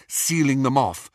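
ordinary delivery

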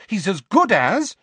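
high, loud, indignant retort; fierce motherly protest. A narrator's reading. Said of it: "said Missus Weasley fiercely".

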